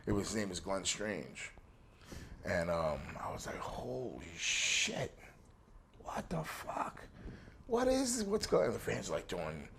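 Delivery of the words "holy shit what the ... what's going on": stunned disbelief. Retold or imitated. Shocked at a wrestling nun.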